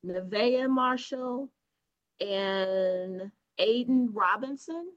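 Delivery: ordinary talk, nothing marked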